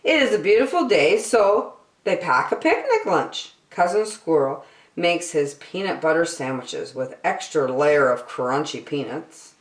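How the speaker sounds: ordinary delivery, nothing marked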